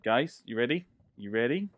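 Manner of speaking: ordinary talk, nothing marked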